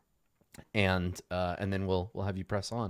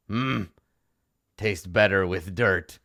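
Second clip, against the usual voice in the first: savoring gnoll; 7 semitones lower, slower than usual. Acted. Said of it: "and he says".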